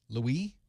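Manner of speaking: LOUIS offered as French-style "loo-EE"; LOUIS rising, with a silent s; trying a pronunciation. Shown as a demonstration. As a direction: say LOUIS like "Louie", questioning. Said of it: "It's one of those, right?".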